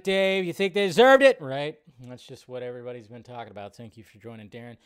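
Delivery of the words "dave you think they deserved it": much louder, higher teasing question; incredulous call-out. Pretend. Challenging Dave in the chat with a loud, incredulous question. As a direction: push the disbelief at him.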